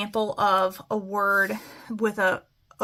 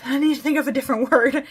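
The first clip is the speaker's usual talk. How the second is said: laughing at herself; 3 semitones higher, 5 dB louder, much faster than usual. Real, not acted. Teacher stuck, laughs at herself.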